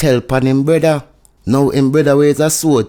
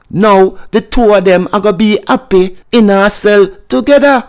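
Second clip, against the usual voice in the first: louder and higher, pressing every point home; emphatic rant; 7 semitones higher, 6 dB louder than usual. Real, not acted. Vlogger hammering his point to viewers, louder and higher. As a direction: raise the voice to drive the argument. Vlogger ranting about the informer.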